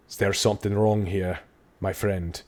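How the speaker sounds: a hint of a calm city guard. Guardsman asks the aristocrat what's wrong, lightly voiced.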